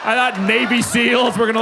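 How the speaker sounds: ordinary talk, nothing marked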